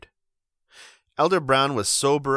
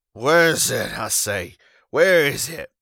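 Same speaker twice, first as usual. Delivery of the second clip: demanding; 4 semitones higher, 7 dB louder than usual. Acted. Wife searching his pockets.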